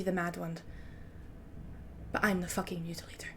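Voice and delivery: put-on quiet, measured French-accented threat. The Hilt warning a rival over kills, hushed French accent. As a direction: menace them softly in a French accent. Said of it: "let me try this accent again".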